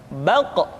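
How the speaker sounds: "Baq" with a bouncing echo after the q; son rebondi. Shown as a demonstration. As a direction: release the final q of "Baq" with a rebound. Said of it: "maka terdengar pantulan suara".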